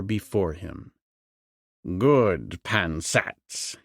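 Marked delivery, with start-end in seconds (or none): 2.0-3.8 a touch of low, gloating approval. A narrator's reading. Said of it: "he exclaimed".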